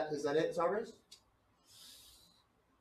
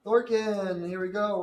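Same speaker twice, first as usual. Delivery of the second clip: high, loud gleeful taunt; taunting call; 7 semitones higher, 10 dB louder, slower than usual. Acted. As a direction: taunt him with relish. DM taunts downed player.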